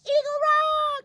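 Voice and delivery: high, drawn-out cry of arrival; excited shout. Real, not acted. Hiker arriving at Eagle Rock, calling out with delight. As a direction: call out the landmark with joy.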